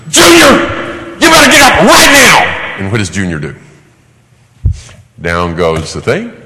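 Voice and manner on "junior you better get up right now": high, loud furious command; ballistic mom. Retold or imitated. Mother screams at Junior to get up, furious high shout. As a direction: scream his name, then bark the order. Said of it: "She's ballistic now".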